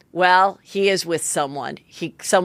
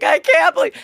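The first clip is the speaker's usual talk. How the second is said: high, crying squeal; imitating her sobbing disbelief; mock crying; 5 dB louder than usual. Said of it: "and she's crying so bad".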